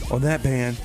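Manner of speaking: normal talking voice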